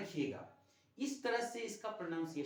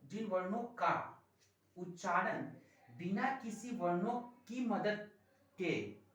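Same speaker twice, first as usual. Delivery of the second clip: dictating slowly; 3 semitones higher than usual. Shown as a demonstration. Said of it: "slow dictation of the definition".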